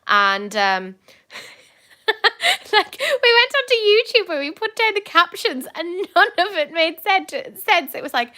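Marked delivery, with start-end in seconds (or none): 2.1-7.9 real laughter breaking into high, loud telling; laughing. Real, not acted. Telling friends about nonsense film captions, laughing. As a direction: tell it through giggles, pitched way up.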